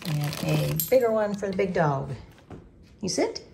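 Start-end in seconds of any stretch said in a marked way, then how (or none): 3.0-3.5 talking to a dog. Real, not acted. Commanding dog at lunch.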